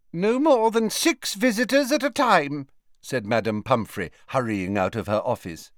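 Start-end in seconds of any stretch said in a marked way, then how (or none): 0.1-2.7 high, brisk matron voice; fussy nurse voice. A narrator's reading. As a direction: play the bustling school nurse. Madame Pomfrey voiced in the audiobook.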